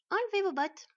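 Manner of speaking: high, loud, commanding woman; bossy dwarf wife. A narrator's reading. Dwarf wife to visitors, high bossy command. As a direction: order them like a busy housewife. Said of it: "leur intima une petite vergue affairée".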